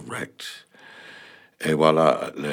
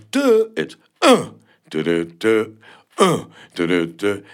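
"un" and "deux" chanted loud, the downbeat "un" much higher; counting on the one; 4 semitones higher, 8 dB louder than usual. Shown as a demonstration. Explaining [name]'s On the One, loud counted beat. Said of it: "On the One".

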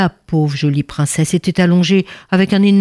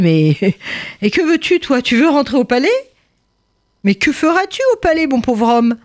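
high, amused guard scoffing at a poor man; mocking palace officer; 6 semitones higher than usual. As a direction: laugh him off like a smug gatekeeper.

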